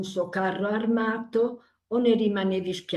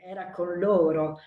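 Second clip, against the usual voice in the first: ordinary talk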